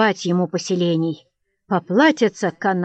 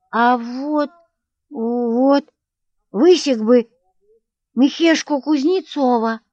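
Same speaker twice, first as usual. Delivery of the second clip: put-on higher, louder little boy; childish, a bit louder; 6 semitones higher, 5 dB louder, slower than usual. A narrator's reading. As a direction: play an eager small boy. Child answers father.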